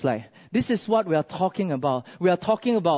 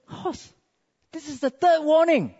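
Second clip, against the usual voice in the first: hushed address, then a higher, firmer warning; acted last-straw warning; final warning; 11 semitones higher than usual. Wife's last warning before shooting.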